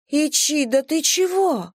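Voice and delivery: high, slow, confused plea; plead, baffled and hurt; bewildered girl voice. A narrator's reading. (растерянно, высоким голосом)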